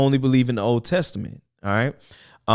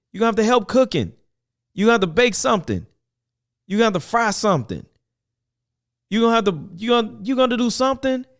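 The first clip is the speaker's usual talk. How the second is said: much higher, fast, insistent urging; pleading exhortation; 10 semitones higher than usual. Real, not acted. Talking to the men watching, urging them to help cook, high and insistent. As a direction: press the fellas to pitch in, voice up high.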